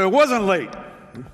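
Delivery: loud, high indignant protest; retelling his argument to the supervisor, heated and loud; heated insistence. Retold or imitated. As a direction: push back hard on the blame. Arguing before the supervisor.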